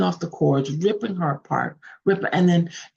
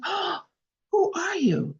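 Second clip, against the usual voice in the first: smitten wonder; 13 semitones higher, 3 dB quieter than usual. A narrator's reading. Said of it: "falling in love with her".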